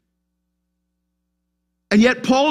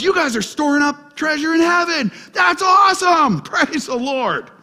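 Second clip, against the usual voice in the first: joyful shout; 7 semitones higher than usual. Retold or imitated. Paul quoted, rejoicing.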